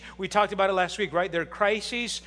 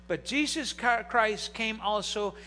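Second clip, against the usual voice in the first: ordinary talk, nothing marked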